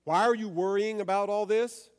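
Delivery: a hammed-up scolding Jesus; mock scolding. Shown as a demonstration. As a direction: scold her sharply, loud and high. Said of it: "Does he say".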